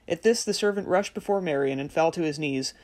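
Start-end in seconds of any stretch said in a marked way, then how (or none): none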